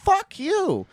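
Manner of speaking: high-pitched exasperated outburst; sudden outburst. Real, not acted. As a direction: blurt it out in disbelief. Ranting at rude customer.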